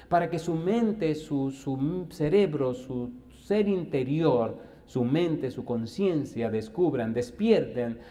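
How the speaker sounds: slow, word by word; emphatic slow preaching. Real, not acted. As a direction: drive each word home. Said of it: "slow emphatic preaching".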